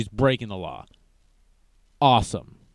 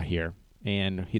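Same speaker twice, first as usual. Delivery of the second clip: ordinary talk, nothing marked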